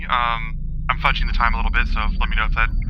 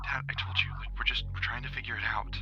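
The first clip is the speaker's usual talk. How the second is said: lightly voiced Kyra; 12 dB quieter than usual. Daughter answers her father about the dog, softly explaining. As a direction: quietly fend off a parent's question. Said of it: "she goes".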